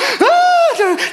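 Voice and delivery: long high mimicked gasp; hyperventilating gasp. Daughter scared by the water, mimicked hyperventilating. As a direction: gasp high like a frantic child. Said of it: "She's hyperventilating at this point".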